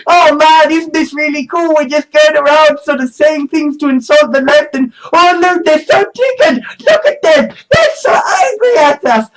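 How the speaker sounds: high, excited troll voice; mocking troll voice. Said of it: "trolls saying".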